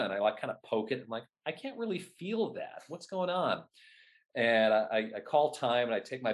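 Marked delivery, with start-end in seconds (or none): 1.5-3.6 higher, baffled self-quote; mildly confused, asking yourself; retold disbelief at a numb thumb; puzzled. Retelling confused moment after injury.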